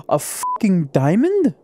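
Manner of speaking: high-pitched, incredulous question; can't believe the lucky find; gasp, then draw it out in shock; stunned disbelief. Real, not acted. Stunned by finding a diamond.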